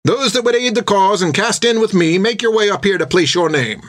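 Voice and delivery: rally the room like a proud naval officer; a sea captain's ringing pitch to a crowd; recruiting sea captain. Acted. Captain calls for volunteers.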